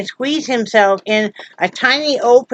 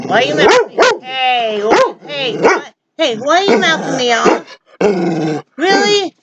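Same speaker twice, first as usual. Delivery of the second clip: mock scolding; 4 semitones higher, slower than usual. Real, not acted. Scolding the barking dog.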